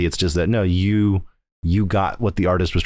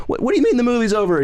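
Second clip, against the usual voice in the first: high, fast, flustered disbelief; mock bewildered mom; faster than usual. Mom questioning her son, fast high mock confusion. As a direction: rush it out high and baffled. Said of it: "She's like".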